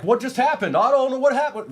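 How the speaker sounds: high, loud, baffled voice; confused player. Retold or imitated. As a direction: play him as totally lost and baffled. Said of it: "He's like".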